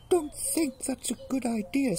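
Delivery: very high mouse voice; worried little mouse. Acted. Mouse about to be eaten, timid squeak.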